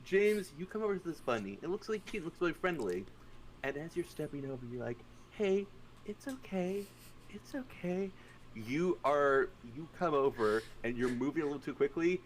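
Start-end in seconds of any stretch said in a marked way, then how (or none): 5.3-8.1 slow, high, soft coaxing; soothing. Acted. James stepping toward a bunny, soft soothing voice. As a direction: coax a small animal gently.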